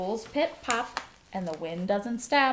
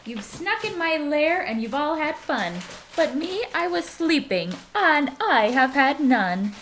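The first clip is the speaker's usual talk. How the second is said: louder, higher wailing bear complaint; upset bear; 7 semitones higher, 8 dB louder than usual. Acted. Bear complaining to the animals who partied without him, loud and wailing. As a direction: whine it like a hurt bear.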